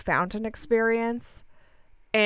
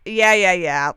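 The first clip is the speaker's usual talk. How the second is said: dismissive brush-off; 7 dB louder, slower than usual. Retold or imitated. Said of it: "like, waving him off".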